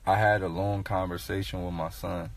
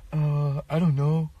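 put-on higher, unsure little boy voice; mock kid mumble, higher; sheepish kid; 7 semitones higher, 4 dB louder than usual. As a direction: play the shy eight-year-old shrugging it off. Son's voice in retold story.

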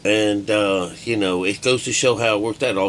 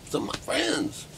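much higher, softer sly repeat of the phrase; mock high aside; 4 dB quieter than usual. Teasing the audience, a mock high-pitched aside. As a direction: toss it off in a teasing falsetto.

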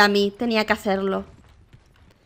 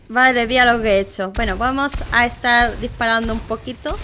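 ordinary delivery

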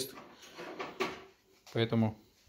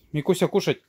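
higher, louder call to a dog; 6 semitones higher, 6 dB louder than usual. Real, not acted. Calling dog to food.

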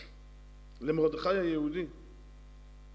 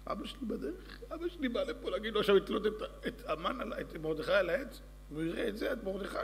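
high eager servant blurting it out; squeaky little informer rushing the news; tattletale servant; 5 semitones higher, 3 dB quieter than usual. Acted. Shimshi voiced, eager tattletale. (קול משרת גבוה ונלהב)

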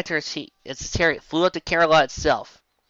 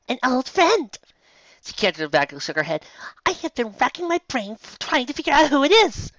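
a higher, lighter voice for the mare; 11 semitones higher than usual. Acted. Featherfall to guard, high and light.

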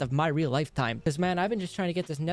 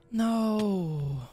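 rising, higher cries of no; dismayed no; 6 semitones higher, much slower than usual. Real, not acted. Player watching the game fail him, high dismayed cries. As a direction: protest at the screen in disbelief.

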